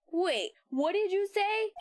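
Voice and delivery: usual speaking voice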